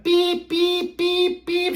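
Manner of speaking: very high, monotone imitated alarm; fire alarm beeping. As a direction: beep like a smoke detector. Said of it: "she hears a fire alarm".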